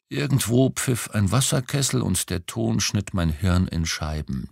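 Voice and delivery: usual speaking voice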